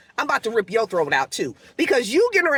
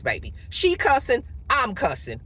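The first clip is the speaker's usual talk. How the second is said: normal talking voice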